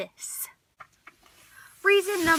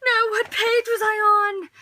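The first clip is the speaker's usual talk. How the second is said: mock panic; 8 semitones higher, 7 dB louder than usual. Frantically searching for the page.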